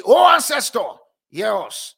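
shouted plea. Retold or imitated. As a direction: shout up to the sky for an answer. Prophets calling on their god.

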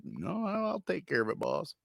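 high, meek mock reassurance; high sheepish reply. Driver answering his angry boss, high meek reassurance. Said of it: "It's like".

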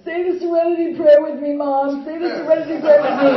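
high, loud, tearful pleading into the phone; drunken crying plea. Retold or imitated. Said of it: "crying and going".

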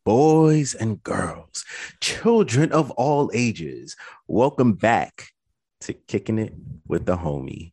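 loud, slow showman intro; ringmaster. Acted. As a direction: announce it like a circus ringmaster. Podcast intro announcer.

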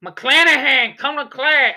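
put-on half-hearted Boston accent, drawn out; attempted Boston accent. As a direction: take a bad stab at a Boston accent. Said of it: "I can't do a Boston accent".

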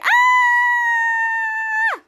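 long high-pitched loud scream; imitated scream. Charlotte sliding down the shaft, long high scream. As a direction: scream all the way down, acting it out. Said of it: "they were both screaming".

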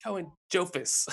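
put-on slow, high little-boy voice; high-pitched little kid. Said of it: "high-pitched voice".

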